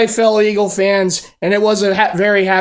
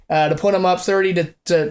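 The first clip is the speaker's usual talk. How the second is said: ordinary talk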